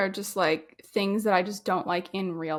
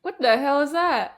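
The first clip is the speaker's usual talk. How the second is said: startled; 5 semitones higher, 5 dB louder than usual. Real, not acted. Startled by a noise.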